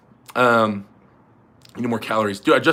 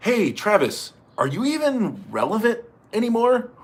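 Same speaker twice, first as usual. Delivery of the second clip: mock questioner; 8 semitones higher than usual. Asking Travis if he's still relevant, high mock questioner. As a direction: pose it high as a teasing interviewer.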